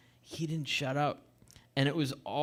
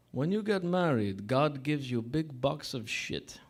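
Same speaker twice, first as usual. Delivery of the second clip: thick mock Russian-Italian accent. Barber's advice to a groom, mock Russian accent. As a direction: tell it deliberately in mangled Russian English. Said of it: "like, a really fucked up accent".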